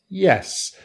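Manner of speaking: "yes" starting high and falling; a sure "yes", dropping down; definite falling yes. Shown as a demonstration. Teacher repeats the definite answer, falling intonation. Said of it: "So it is yes, definitely".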